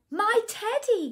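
high-pitched exclamation, falling at the end; crying out. A narrator's reading. Boy spots his lost teddy, crying out. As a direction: cry out as a storybook boy. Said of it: "cried Eddie".

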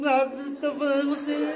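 put-on high-pitched garbled Portugal accent; mock European Portuguese. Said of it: "Aí começa a falar assim".

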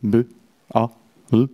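put-on each sound on its own for a toddler; coaxing a small child through the separate sounds of B, A, L; letters sounded out. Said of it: "zeg eens bal".

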